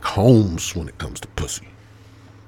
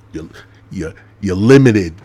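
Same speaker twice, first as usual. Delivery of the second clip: louder emphatic limited; punchline; 11 dB louder than usual. Acted. Explaining his LLC joke, loud punchline. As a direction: land the joke hard.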